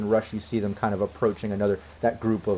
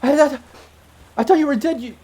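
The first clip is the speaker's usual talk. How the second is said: high, loud, stammering disbelief; acted overwhelmed shock, pitched way up; freaking out; 14 semitones higher, 8 dB louder than usual. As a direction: freak out as a woman finding a lost love alive. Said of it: "she just freaks out".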